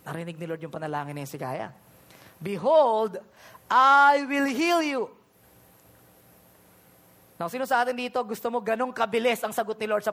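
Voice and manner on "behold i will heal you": loud, slow, booming promise; proclamation. Acted. God proclaiming healing to Hezekiah, loud and slow. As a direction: announce healing like God's voice.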